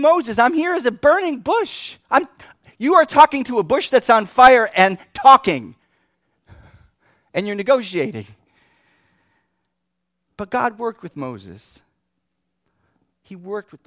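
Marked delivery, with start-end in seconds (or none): 0.0-8.3 high, loud, incredulous rant; angry God. Acted. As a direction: shout in exasperation at stubborn Moses. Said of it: "he's angry with this guy 'cause he just won't believe".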